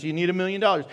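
ordinary delivery

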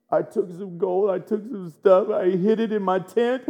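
lightly voiced confession, building up; guilty confessor. Achan caught with the gold, mock guilty confession. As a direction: start owning up in a put-on voice.

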